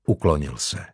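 plain reading voice

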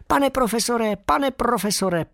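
high, eager squeak calling the professor; a little bat squeaking with joy; excited squeaking. A narrator's reading. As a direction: squeak out his name in delight. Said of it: "pískal jsem o sto šest".

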